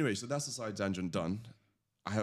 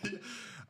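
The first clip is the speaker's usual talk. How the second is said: breathy laugh; 11 semitones higher, 7 dB quieter than usual. Real, not acted. Speaker laughs.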